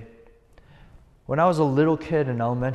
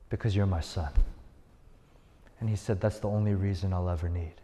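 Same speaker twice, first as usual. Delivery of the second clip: tender father; 6 semitones lower, 5 dB quieter, faster than usual. Retold or imitated. Father's loving answer to son.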